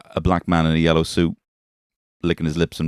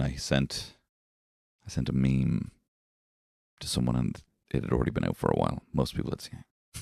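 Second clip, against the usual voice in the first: much softer, fading to whisper; embarrassed hush; 4 semitones lower, 9 dB quieter than usual. Real, not acted. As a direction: confess it under the breath. Embarrassed confession, trailing quiet.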